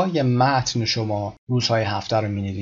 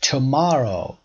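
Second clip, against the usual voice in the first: MOR in Tomorrow raised and stressed; stress on middle syllable; 2 semitones higher, much slower than usual. Shown as a demonstration.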